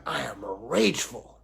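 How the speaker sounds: hammed-up declaration of being rageful; acted rage. Teacher shows self lost in rage, acted rage. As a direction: play someone wholly taken over by rage. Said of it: "especially rage".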